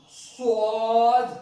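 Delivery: سواد slow and loud with a thick heavy ص; ص held heavy in سواد; heavy Saad. Shown as a demonstration. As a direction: hit سواد hard and heavy. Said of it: "سین سے تبدیل کرتے ہیں".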